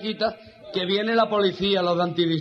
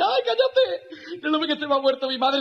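mock tearful; 9 semitones higher than usual. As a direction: whine through exaggerated grief. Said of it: "Llorando las veinticuatro del día".

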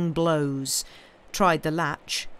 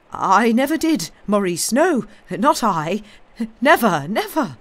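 higher, loud, panicked denial; terrified; 5 semitones higher, 7 dB louder than usual. Acted. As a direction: plead in rising panic. Terrified man denies the charge.

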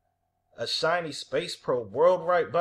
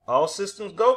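higher, louder launch call; rallying cry; 5 semitones higher, 6 dB louder than usual. A narrator's reading. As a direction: call out ready for take-off. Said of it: "cried Hubble".

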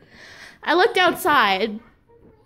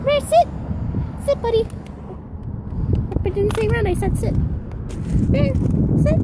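high-pitched scolding of the dog; firm dog command; 8 semitones higher than usual. Real, not acted. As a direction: order the puppy to sit.

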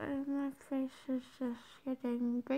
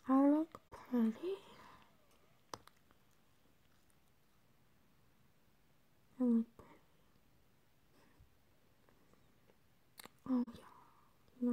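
whispering. Real, not acted. Others asleep, late night.

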